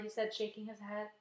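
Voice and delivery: ordinary delivery, nothing marked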